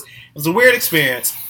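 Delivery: ordinary talk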